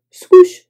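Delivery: high, loud swish. A narrator's reading. Narrator sounding swishing noise, loud. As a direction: whoosh it.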